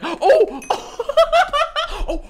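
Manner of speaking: high, breathless giggling fit. Real, not acted. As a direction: let the giggles spill out. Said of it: "I'm just giggling right now".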